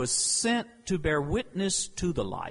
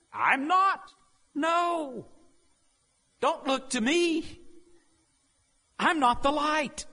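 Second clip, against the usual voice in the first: high, firm refusal; John's firm denial; 11 semitones higher, slower than usual. Acted. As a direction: push them away from yourself. John's refrain of denial, acted.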